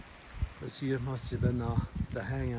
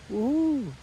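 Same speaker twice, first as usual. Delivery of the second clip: high, ironic cheer; sarcastic woohoo; 12 semitones higher than usual. Real, not acted. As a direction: cheer it, tongue in cheek. Sarcastic cheer about rain hike.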